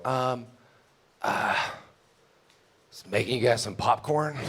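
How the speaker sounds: sheepish mumble. Retold or imitated. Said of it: "And I just go".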